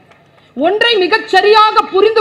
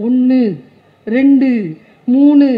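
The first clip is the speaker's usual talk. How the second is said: each count louder than the last, a referee's count; 3 semitones lower, 4 dB louder, slower than usual. Retold or imitated. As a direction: call the count like a boxing referee. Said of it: "referee வந்து சொல்வாரு". (குத்துச்சண்டை நடுவர் போல ஒவ்வொரு எண்ணையும் உரக்க எண்ணுதல்)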